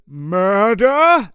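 hammed-up creature question; mock puzzled monster. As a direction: tilt the word, baffled.

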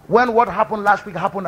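usual speaking voice

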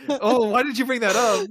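mock dismay. Real, not acted. Reacts to grim implication.

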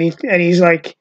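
ordinary delivery

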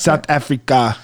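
mock South African accent. Shown as a demonstration. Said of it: "Can you do a South African accent?".

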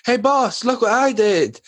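high, bright showing-off voice; proud eager kid. Retold or imitated. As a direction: show off like a proud child. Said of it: "you were like".

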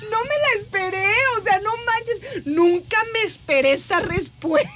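high, slow, crying with laughter; can barely get it out for laughing. Real, not acted. Reacting to the nurse's joke, laugh-crying.

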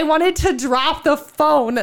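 astonished. Real, not acted. Telling her friend about the uncanny message, astonished. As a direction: stretch it out in amazed emphasis. Recounts her shock at the reading.

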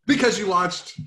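big booming circus announcer; ringmaster. Pretend. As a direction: announce it high and loud. Showman intro, later said plainly.

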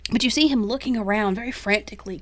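normal talking voice

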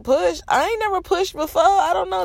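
high shocked protest in the delivery room; panicked disbelief. Retold or imitated. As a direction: retell your own panic at being told to push. Her own panicked reply in labour.

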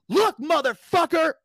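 high, loud angry outburst; going off. Retold or imitated. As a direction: blow up at him, drawing out the words. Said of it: "And I went off".

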